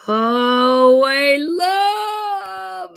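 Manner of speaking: loud, very slow proclamation; drawn-out shout. Acted. Vlogger belting a mock line to viewers, loud and drawn out. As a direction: stretch it out and belt it.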